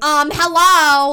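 slow, loud, drawn-out "Um, hello"; mock New York accent. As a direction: stretch "hello" like a New Yorker. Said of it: "Kara will be like".

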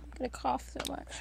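normal talking voice

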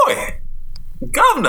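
high shouted Cockney call; Cockney accent. Pretend. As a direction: bark it like a London geezer.

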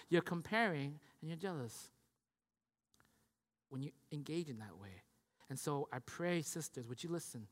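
low, very soft appeal; hushed pleading preaching. Real, not acted. Preacher pleading with sisters to listen, hushed. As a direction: let it sink to a gentle plea.

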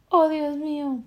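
high, loud tearful voice; a sobbing plea from the ex; crying girlfriend. Acted. Ex-girlfriend phoning, crying and loud. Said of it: "llorando mientras te dice".

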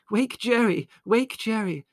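high-pitched, loud alarm; urgent shout. A narrator's reading. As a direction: shout orders in a flustered rush to rouse the house. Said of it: "she shouted".

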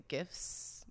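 "gifs" with a hard G as in "gift". Shown as a demonstration. Host quoting other people's version, "gifs" with hard G. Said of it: "I hear people say".

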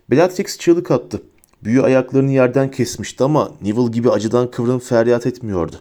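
ordinary delivery

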